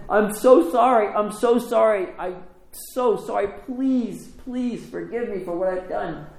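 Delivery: high, pleading mock-tearful apology; imitated weepy plea, pitched well up. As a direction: beg forgiveness, acting out someone in tears. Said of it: "Tanasi's crying".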